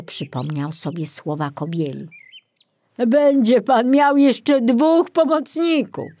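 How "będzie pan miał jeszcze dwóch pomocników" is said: slow, louder recall, stressing dwóch; Piątek remembering Kobiela's words; recalled old forester. Retold or imitated. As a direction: echo the old forester's promise.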